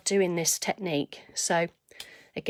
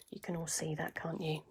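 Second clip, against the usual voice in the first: hushed aside; 3 semitones lower, 13 dB quieter than usual. Real, not acted. Crafter checking viewers on live stream.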